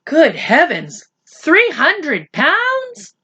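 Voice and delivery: very slow, high on the amount; a father stunned by the prize money; reverent awe. Acted. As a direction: savour the sum in awe. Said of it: "his father breathed reverently".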